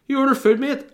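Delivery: a high, loud mimic of the pizza man; delivery guy impression. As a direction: pitch it way up and bark it like a bored courier at the door.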